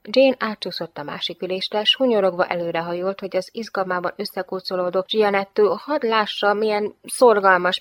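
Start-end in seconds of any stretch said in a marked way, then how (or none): none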